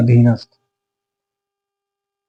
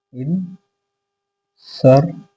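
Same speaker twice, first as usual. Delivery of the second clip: very slow dictation; 3 semitones higher, much slower than usual. Shown as a demonstration. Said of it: "slow dictation".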